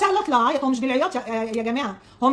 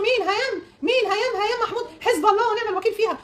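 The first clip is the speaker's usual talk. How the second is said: outraged commenters; 6 semitones higher than usual. Retold or imitated. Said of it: "خليتي الناس في الكومنتات في اللي يقولوا".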